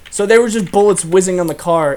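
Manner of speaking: normal talking voice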